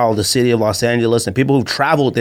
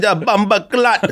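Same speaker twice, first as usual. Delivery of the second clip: put-on Jamaican accent; 8 semitones higher, slower than usual. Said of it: "He said".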